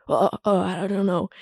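imitating an awkward, hesitant reply; mock-uneasy stalling, trailing into softness; uncomfortable. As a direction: stammer softly as if put on the spot. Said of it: "He was so uncomfortable".

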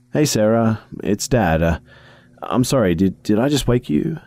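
lightly voiced apologetic dad; a gentle dad calling; caring father.

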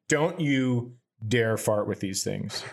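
ordinary delivery

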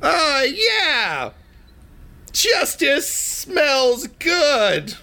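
high, drawn-out triumphant crowing; imperious Poop Lord. Acted. Poop Lord standing over melting Cinder, imperious high crow. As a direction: crow it slowly and high, lording it over him. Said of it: "looking down imperiously".

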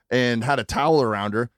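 ordinary talk

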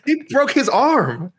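very high, stretched-out exclamation; incredulous protest. Real, not acted. Cohost arguing the submission deserved a bonus, incredulous. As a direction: draw it out high in disbelief.

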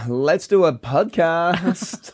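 very slow, drawn-out suggestion; lightly mocking pitch; mock eager idea. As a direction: drawl the bright idea. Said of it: "And then you're like".

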